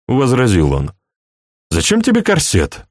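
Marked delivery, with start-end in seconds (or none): 1.7-2.9 much higher, puzzled question. A narrator's reading. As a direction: ask it up high, puzzled. Husband asks while undressing her.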